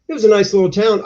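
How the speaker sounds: normal talking voice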